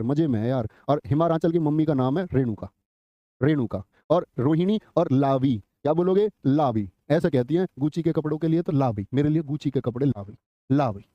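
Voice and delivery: ordinary talk, nothing marked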